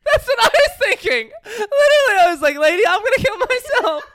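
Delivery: very high, loud, squealing through laughter; helpless laughter. Real, not acted. Storyteller agreeing with co-host's joke, helpless laughter. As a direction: talk while cracking up completely.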